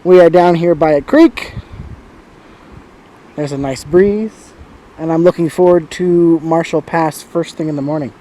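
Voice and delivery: usual speaking voice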